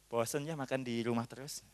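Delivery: low, soft acted hint; wife's hinting murmur. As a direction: drop it quietly as a roundabout hint. Wife hints indirectly at wanting meatballs. (gumaman istri memberi kode, pelan)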